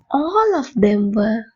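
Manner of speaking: each word drawn out for learners to repeat; slow, clear teacher model for the class; very slow drill. Shown as a demonstration. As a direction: say it very slowly so the class can follow. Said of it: "என் கூட சேர்ந்து சொல்லி பாருங்க".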